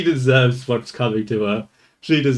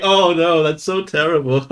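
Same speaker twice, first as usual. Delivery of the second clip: higher, mocking false sympathy; mock fake crying; 4 semitones higher, 4 dB louder than usual. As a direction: imitate her put-on distress. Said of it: "She's fake crying".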